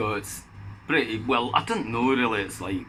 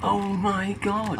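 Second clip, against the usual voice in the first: drawn-out, much higher gasp of amazement; amazed; 10 semitones higher, much slower than usual. Real, not acted. Hearing Abbey Road is number one again, slow amazed exclaim. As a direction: stretch it out slowly, stunned.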